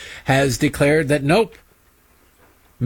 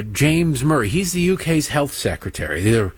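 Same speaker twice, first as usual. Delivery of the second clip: ordinary talk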